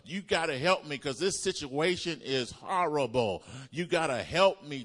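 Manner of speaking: high, loud desperate begging; mock desperate plea. Preacher mocking desperate prayer, loud high begging. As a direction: plead as if the situation's hopeless. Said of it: "That means just like what the word says".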